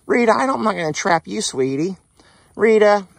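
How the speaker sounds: very high, loud pleading call; coaxing then calling. Real, not acted. Owner pleading with Rita she won't be trapped, high loud calling. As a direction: reassure her, then call her name.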